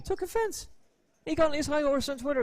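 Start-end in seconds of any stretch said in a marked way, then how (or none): 1.2-2.4 mock outrage. Mocking the offended man's high horse.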